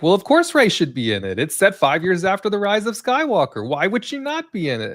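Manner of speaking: high-pitched mock fan insistence; imitating sequels fans. Podcaster imitating fans defending Rey's inclusion, high mock indignation. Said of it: "small contingent of sequels fans".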